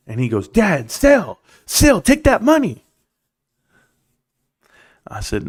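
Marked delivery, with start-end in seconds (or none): 0.5-2.8 put-on high, urgent kid voice begging dad to sell; a pushy boy pleading to cash out now; imitating his son. Son urging his dad to sell, high and insistent.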